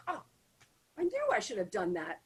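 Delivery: ordinary delivery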